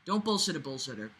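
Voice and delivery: ordinary delivery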